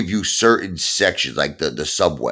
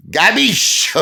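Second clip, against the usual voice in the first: higher Italian-American "Gabish"; tough-guy Italian capisce; mock Italian; 4 dB louder than usual. New Yorker ribbing viewers in mock Italian.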